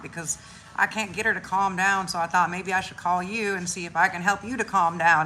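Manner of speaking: ordinary talk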